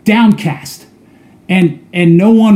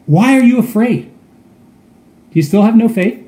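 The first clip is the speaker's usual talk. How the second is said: gentle reproach; 4 semitones higher than usual. Acted. Jesus asking frightened disciples, gently reproachful. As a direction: ask them tenderly why they doubt.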